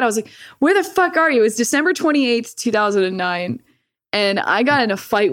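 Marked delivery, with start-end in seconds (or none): 0.6-1.4 angry yell. Retold or imitated. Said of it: "I got mad at my dad".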